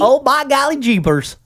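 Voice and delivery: hammed-up folksy country accent. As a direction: play a corny country bumpkin. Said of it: "I like when you do that accent".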